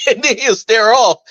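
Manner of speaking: usual speaking voice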